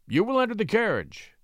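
mildly commanding, smoothly polished; a touch of practised, smooth authority; large smooth voice. A narrator's reading. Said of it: "in a voice large, like himself, but smoothed by art and habit".

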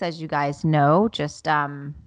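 usual speaking voice

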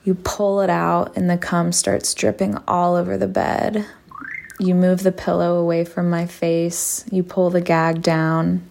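plain reading voice

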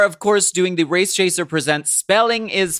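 loud, grand title announcement; announcer voice. Acted. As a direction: announce it like a show host. Announcing long show title.

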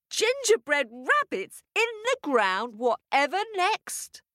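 high-pitched amazed squeal; farmer's wife squealing in wonder, high; delighted shriek. Acted. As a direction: shriek with happy astonishment. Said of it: "The farmer's wife shrieked".

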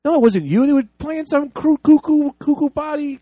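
exasperated outburst. Real, not acted. Director rants at actor in a high, loud outburst. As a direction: shout it in disbelief. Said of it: "exasperated outburst".

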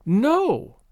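sharp high burst of a no; bursting out her denial; explosive no. A narrator's reading. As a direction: fire the word out. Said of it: "she asserted explosively".

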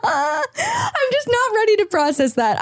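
high, slow, laughing through it; words squeezed out through laughter. Real, not acted. Laughing at her own joke.

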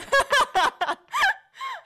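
loud, high-pitched burst of laughter; helpless laughter. Real, not acted. Realising she said shoplifting, real loud laughing fit. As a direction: crack up loudly at your own slip.

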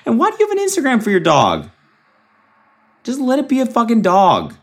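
very high-pitched incredulous whine; comic high-voiced exasperation; exasperated squeal. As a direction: plead in a strained high squeak. Mock rant at dog owners.

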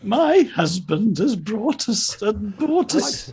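slow, high, plummy posh English. Pretend. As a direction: lay on a posh lady accent, slowly. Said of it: "put on a posh voice".

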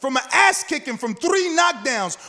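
loud, high-pitched incredulous rant; worked-up, mocking challenge; heated tirade. Real, not acted. Heated argument over a comeback fight.

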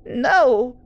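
high, thin alarmed mother voice; the fond mother turns fearful; fearful elderly woman; frightened old mother. Acted. Mother refusing in fear.

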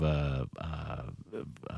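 ordinary delivery

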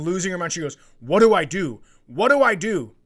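ordinary delivery

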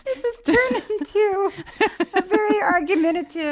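high-pitched, real laughing voice. Real, not acted. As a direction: squeeze the words out through high laughter. Teases through laughter about couples' row.

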